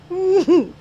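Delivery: sudden high laugh bursting out; loud burst of laughter. Real, not acted. Owner laughing at the dog licking her face. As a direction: crack up at the dog's antics.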